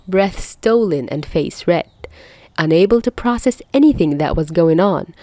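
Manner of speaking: usual speaking voice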